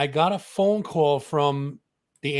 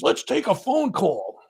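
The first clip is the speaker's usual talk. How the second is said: higher, slow hammed-up host voice; 8 semitones higher than usual. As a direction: take over the show like the monster character. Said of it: "odorous mode".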